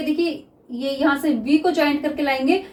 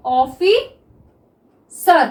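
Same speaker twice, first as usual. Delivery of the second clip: Officer drawn out very slowly; teacher dictating officer to students; slow dictation; much slower than usual. Shown as a demonstration. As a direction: stretch officer as if writing it down. Dictating while writing shorthand.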